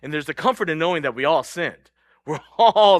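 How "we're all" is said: a joyful laughing admission. Real, not acted. Preacher tells the congregation we're all sinners, laughing. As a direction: laugh through it, louder and slow.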